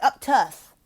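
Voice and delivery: interrupting correction. Shown as a demonstration. The wrong way: tutor cuts off a struggling reader. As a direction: jump in over the reader. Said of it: "don't cut them off and be like".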